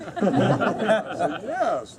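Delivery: laughing through the words. Retold or imitated. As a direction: burst out laughing as you say it. Laughing retelling of saying yes.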